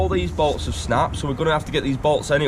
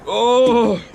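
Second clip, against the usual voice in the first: startled yelp; 11 semitones higher, 6 dB louder than usual. Real, not acted. Sudden cry while working.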